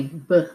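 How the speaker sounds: b as "buh", not "bee"; letter sound. Shown as a demonstration. Teacher gives the new sound, b as "buh". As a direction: say the short sound b makes, "buh". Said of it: "change to a new sound".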